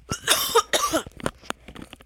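harsh rasping coughs; coughing fit. Real, not acted. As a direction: keep coughing, can't stop. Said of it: "I'm choking on the words".

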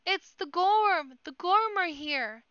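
high, loud, drawn-out cry of alarm; panicked shout. A narrator's reading. Crowd seeing the dreaded enemy arrive, panicked shout. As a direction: wail the warning to everyone, stretching the words. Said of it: "The last shout caused mass panic".

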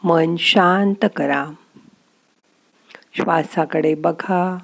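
slow, soft calming meditation voice; guided meditation. Acted. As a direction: ease the listener into stillness, slowly. (शांत, हळू ध्यान-मार्गदर्शनाचा आवाज)

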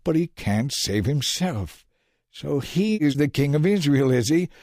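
mock his claim to be king; scoffing. A narrator's reading. Leaders scoff at Jesus on the cross, sneering. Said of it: "they scoffed".

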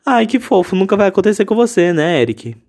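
high, sing-song mock sweetness, teasing himself; self-mocking inner voice. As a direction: tell yourself nobody will ever do this for you, in high mocking sweetness. Mocking his own wishful inner voice.

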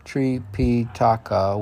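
normal talking voice

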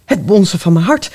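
usual speaking voice

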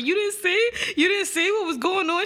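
high disbelieving question; incredulous. Retold or imitated. Retelling her own call to friend.

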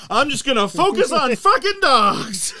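high, loud, slow mock proclamation; cartoonish drawn-out announcement; hammed-up declaration. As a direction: stretch it out like a grand vow. Acted resigned immigrant quote.

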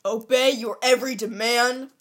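loud dramatic poem recital; dramatic poem reading. Acted. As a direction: declaim it like a wounded teen. Reads poem aloud.

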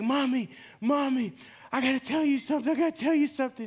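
put-on high-pitched, urgent little boy voice; worried child. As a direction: imitate an anxious kid pleading with mom. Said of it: "he was very concerned. He was afraid".

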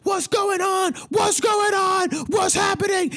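high, shouting panicked voice; panicked workers. Retold or imitated. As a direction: play the frantic cleanup crew. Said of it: "they're going crazy".

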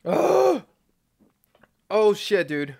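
sudden high gasp at the heat; shocked gasp. Real, not acted. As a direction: caught off guard by the burn. Hot sauce burning.

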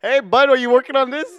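put-on loud, high hockey-bro Canadian accent. Imitating coworker on the phone, loud Canadian hockey accent. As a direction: holler it as an exaggerated Canadian buddy.

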